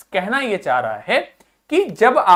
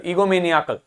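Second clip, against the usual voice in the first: ईगोमेनियाकल as one word, stressed on निया; निया standing out within an unbroken ईगोमेनियाकल; 2 semitones lower than usual. Shown as a demonstration. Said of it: "निया अलग से सुनाई दे रहा".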